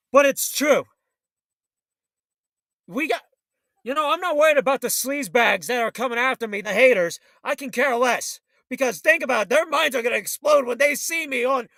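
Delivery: ordinary talk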